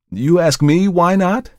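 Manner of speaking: high, loud and slow; intense challenge. Acted. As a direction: hurl the question back hard. Markheim confronts dealer.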